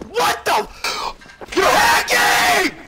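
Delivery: shocked gamer yell; hyped gamer bro. Real, not acted. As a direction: yell it out, outraged. Accused of hacking mid-game.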